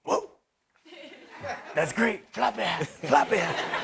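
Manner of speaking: delighted Bruce. Retold or imitated. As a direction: gush over the hat like Bruce. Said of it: "he goes".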